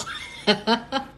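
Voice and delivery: loud burst of laughter; real laugh. Real, not acted. Laughing with colleague about her full table, loud real laugh.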